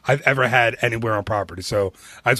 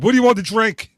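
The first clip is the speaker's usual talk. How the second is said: retold curt, higher, louder demand; gruff server; 8 semitones higher, 4 dB louder than usual. Server snapping at the table for drink orders, mock gruff. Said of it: "and she's like".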